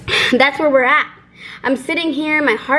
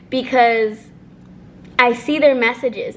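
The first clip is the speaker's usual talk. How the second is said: ordinary delivery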